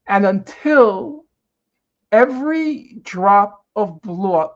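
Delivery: slow, weighty paraphrase; slow emphatic reading. Shown as a demonstration. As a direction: spell it out slowly, with gravity. Reads text slowly, explaining.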